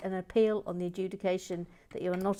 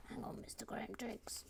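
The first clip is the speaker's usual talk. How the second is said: whisper; 3 semitones higher, 15 dB quieter than usual. Shown as a demonstration. Repeats the call, then whispers it.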